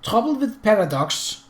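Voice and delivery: put-on German accent. A narrator's reading. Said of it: "deep, guttural voice with a German accent".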